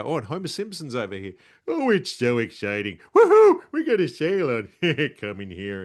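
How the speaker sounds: high, loud hyped-up sales cry; over-the-top shopfront hype; excited spruiker. Shown as a demonstration. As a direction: whip the crowd up with a big woohoo. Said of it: "give us a little bit of a sample".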